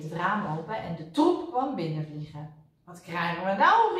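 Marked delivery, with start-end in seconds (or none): 2.8-4.0 loud startled cry; calling out. A narrator's reading. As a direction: call out in disbelief. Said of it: "riep Kwark".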